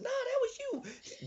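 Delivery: high, slow accusing grandma; high slow mock grandma. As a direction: pin it on him as granny. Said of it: "He said".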